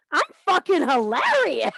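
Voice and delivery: high, loud mock-indignant; indignant boast. Wife answering her husband, loudly boasting in mock indignation. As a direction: insist on it proudly. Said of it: "I'm like".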